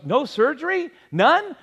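high, incredulous rising questions; can't believe what he hears; stunned disbelief. Retold or imitated. Patient to nurse on learning no surgery, high incredulous disbelief.

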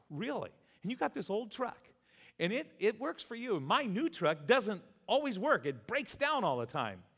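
high-pitched acted-out indignation; incredulous complaint. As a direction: protest the unfairness in a high, exasperated voice. Retold incredulous complaint about truck.

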